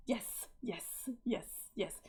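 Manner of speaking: hushed repeated yes. Acted.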